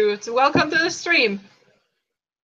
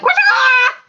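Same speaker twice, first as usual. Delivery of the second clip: loud, very high chicken screech; chicken squawk; 13 semitones higher, 7 dB louder than usual. Pretend. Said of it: "like you just suddenly turned into a chicken".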